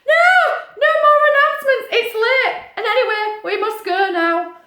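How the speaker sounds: high, loud, comic protest; mock exasperated. Storyteller cutting off the announcer, high loud mock protest. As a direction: cut in, shooing the announcer off.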